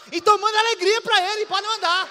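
shouting the brain's joyful command; excited shout. Acted. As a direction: proclaim it like an order from the brain. Brain voiced commanding joy.

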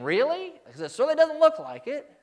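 loud, high disbelief; incredulous doubt. Pretend. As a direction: challenge it as a sceptic would. Doubting listener voiced.

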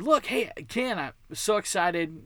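ordinary delivery

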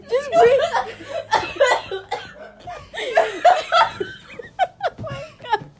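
high-pitched laughing through words. Real, not acted. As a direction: crack up mid-sentence. Both laughing hard.

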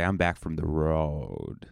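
road comic phrasing. Shown as a demonstration. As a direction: savor the words like a road comic. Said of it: "that's how you say it".

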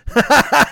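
sudden high laugh; real laughter; big laugh. Real, not acted. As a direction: burst out laughing at the punchline. Cracks himself up at the punchline.

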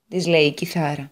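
ordinary delivery

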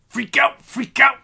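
raspy high wrestler growl; Macho Man. Retold or imitated. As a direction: hype it like a wrestling promo. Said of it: "Macho Man's back".

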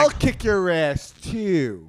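loud threat. Real, not acted. Threat at people outside.

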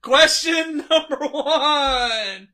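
slow, loud, laughing host voice; game show host. Acted. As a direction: stretch it out like a big announcer. Quiz host repeats, hamming it.

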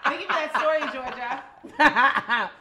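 laughing. Real, not acted. Laughing thanks to Georgia.

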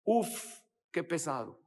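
weary, exasperated moaning; a mock fed-up complaint; complaining sigh. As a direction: groan it out like you're fed up. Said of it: "Es una expresión de queja".

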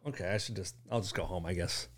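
low, quiet, deflated retreat; small, dejected acting-out of giving up; retold letdown. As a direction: let it sink away in disappointment. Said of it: "But it was like".

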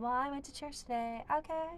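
soft, offhand imitation of a box-ticking believer; mock churchgoer. Preacher mimicking a half-hearted churchgoer, soft and dismissive. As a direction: toss it off like someone just punching a card.

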